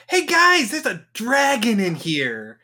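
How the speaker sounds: very high, slow, cheerful call; excited squeal. Acted. Adventurer squealing to her friends about the dragon. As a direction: sing out the news brightly like it's no danger. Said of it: "It's like".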